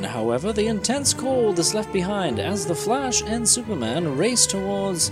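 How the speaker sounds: ordinary talk, nothing marked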